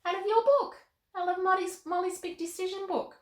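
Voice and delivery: put-on high, bright little girl puppet voice; Molly the puppet chatting with Carmen, in a high girl voice.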